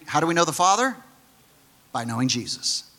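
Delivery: normal talking voice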